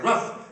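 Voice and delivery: high, loud yappy bark imitation; dog bark. Comic imitating his dog's sharp high bark. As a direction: yap it out like a small dog.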